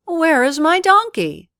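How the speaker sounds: high, loud startled cry; shocked to find a man on the rope; surprised. A narrator's reading. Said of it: "He was surprised and asked".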